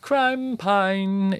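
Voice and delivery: high-pitched goofy voice; high silly voice. Acted. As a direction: echo it in a squeaky voice. Echoing code in silly voice.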